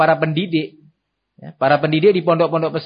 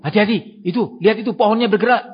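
higher-pitched scare tactic, urgent; mock scary warning; 5 semitones higher, faster than usual. Parent scaring a crying child with a moving tree, mock warning. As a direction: imitate a parent frightening a child. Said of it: "ditakut-takuti dengan satu".

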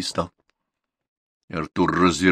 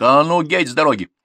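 raised, louder angry order; heated, higher-pitched command; boiling over; 7 semitones higher, 5 dB louder than usual. A narrator's reading. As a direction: order him off the road in temper. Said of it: "вскипел мужик".

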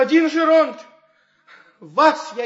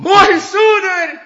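grateful cry; 6 semitones higher, 10 dB louder, slower than usual. Acted. Grateful outburst.

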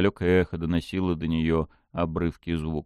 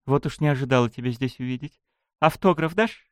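higher, quick nervous quip; nervous joking; 5 semitones higher than usual. Acted. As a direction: joke to hide the fear. Nervous joke to demon.

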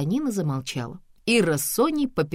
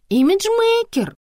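very high, loud, drawn-out title; proud announcement; 8 dB louder, much slower than usual. A narrator's reading. Antonina naming her profession, proudly. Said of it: "гордо сказала Антонина".

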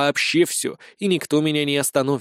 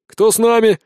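calling out; 7 semitones higher, 6 dB louder than usual. A narrator's reading. Calling others to bet.

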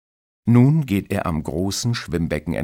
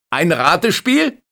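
high, loud sarcastic yell; mocking shout; 13 semitones higher, 9 dB louder than usual. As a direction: shout the jab over the noise. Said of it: "schreit der Bademeister". (spöttisch laut gerufen)

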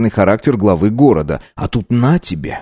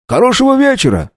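«вечера» very high and stressed; «Хорошего вечера» called out high, falling; eager coachman. A narrator's reading. Coachman bids farewell. (радостно «Хорошего вечера»)

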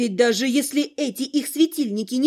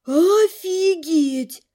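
long drawn-out, awestruck "Офигеть"; slow, astonished exclamation; utter amazement; 3 semitones higher, much slower than usual. A narrator's reading. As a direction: stretch "Офигеть" out slowly, completely stunned. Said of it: "В полном изумлении".

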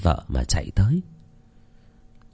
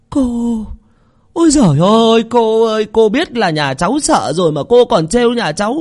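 much higher, whiny and still shaken; shaken whining plea; 13 semitones higher than usual. Acted. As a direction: scold Cô Năm for the scare. Relieved wife complains to Cô Năm. (rên rỉ trách móc, giọng cao còn run)

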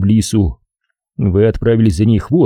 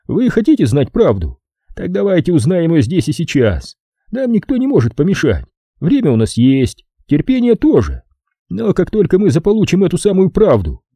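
higher, pressing character voice; Fowler the boss, insistent; 9 semitones higher than usual. Acted. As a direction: press your bargain, pitched well up. (настойчивый босс, высокий голос)

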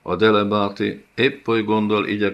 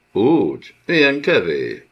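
louder and higher, scornful disbelief; mocking tyrant; 4 semitones higher, 5 dB louder than usual. The karasz mocking the defiant nomad with scornful, raised disbelief. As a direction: taunt him as if he were a toy.

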